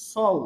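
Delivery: সউ with a light Bengali 's'; common mispronunciation. Shown as a demonstration. Teacher showing the common mistake, mispronounced. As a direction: say সউ with a thin, flat-tongued s. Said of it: "আমরা এখানে common যে ভুলটা পড়ি".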